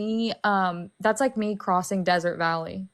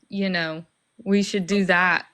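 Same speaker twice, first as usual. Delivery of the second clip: ordinary talk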